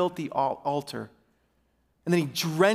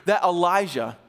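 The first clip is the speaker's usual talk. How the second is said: ordinary talk, nothing marked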